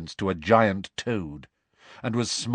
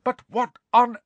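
high, forceful, incredulous telling-off; angry scolding; 12 semitones higher, 4 dB louder than usual. A narrator's reading. Professor scolding two students for fighting, angry. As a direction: stretch the key words in outrage, pitched high.

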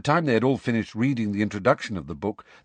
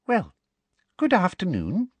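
high-pitched, sugary greeting; prim teacher; 11 semitones higher than usual. A narrator's reading. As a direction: greet the class in a high, girlish lilt. Umbridge greets her class.

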